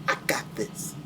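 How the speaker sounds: whispered aside to the others. Acted. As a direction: murmur it just to yourself. Character reassures under breath.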